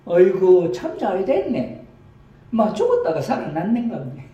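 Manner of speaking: loud, high Gyeongsang granny relief; Gyeongsang grandma. Acted. Grandma rejoices he came back to life, in high Gyeongsang dialect. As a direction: exclaim like a relieved old country woman. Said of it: "경상도 버전으로".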